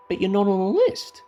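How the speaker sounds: high, puzzled objection; protesting. Retold or imitated. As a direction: point out the obvious in disbelief. Repeating it with the list shown.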